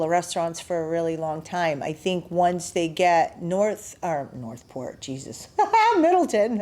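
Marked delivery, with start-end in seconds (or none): 5.0-6.6 amused laugh, pitch jumps up; laughing at a slip. Real, not acted. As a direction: laugh at your own mistake. Laughs at own slip of name.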